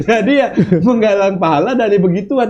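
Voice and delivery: amused laugh while speaking. Real, not acted. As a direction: chuckle the words out. Joking about insults as rewards.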